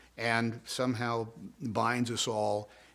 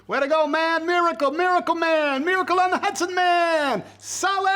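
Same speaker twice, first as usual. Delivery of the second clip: high, loud cheering fan; hollering praise from a distance; fan shouting; 9 dB louder than usual. Retold or imitated. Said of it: "the Sully screamed at me".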